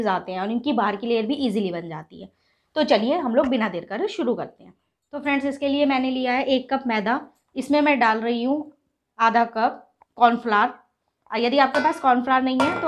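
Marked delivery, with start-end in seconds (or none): none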